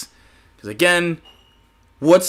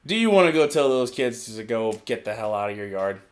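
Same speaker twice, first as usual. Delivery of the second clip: normal talking voice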